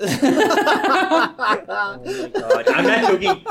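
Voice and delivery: high squealing laugh through words; can't stop laughing. Real, not acted. Cracking up at story.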